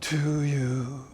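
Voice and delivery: soft, airy breathy singing; too much air. Shown as a demonstration. Voice coach demoing an airy breathy voice. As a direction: sing it with air leaking out. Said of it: "there's just too much air coming out".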